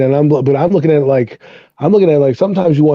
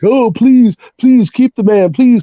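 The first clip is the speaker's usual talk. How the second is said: higher-pitched imitation of begging; mock pleading; 5 semitones higher than usual. Fan mockingly begging to keep the quarterback, pleading. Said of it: "we beg and plead and hope".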